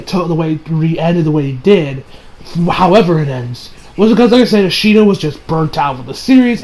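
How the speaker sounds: usual speaking voice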